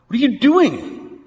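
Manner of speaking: a hint of a furious king; outraged king. The king of Moab confronting Balaam in outrage.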